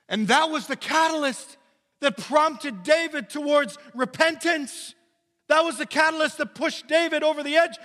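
loud, high preacher's cry; full-force preaching. Acted. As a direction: preach it hard to the room.

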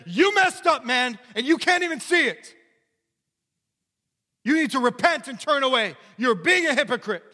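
loud, high confrontational rebuke; Nathan rebuking. Acted. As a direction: jab the words at David's face. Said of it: "points in his face and says".

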